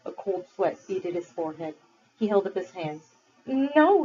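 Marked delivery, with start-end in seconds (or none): 3.5-4.0 high, desperate pleading; pleading boy. Acted. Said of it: "he pleaded".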